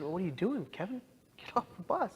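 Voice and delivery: pitched well up, urging himself to get off; urgent self-talk. Retold or imitated. Kevin urging himself off the bus, high and urgent. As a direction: snap yourself out of it, high and pressing.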